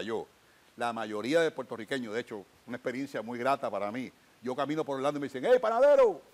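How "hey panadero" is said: shouted greeting, pitched way up; loud street hail; calling out. Retold or imitated. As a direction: call out across the street to a friend. People call out to him in Orlando.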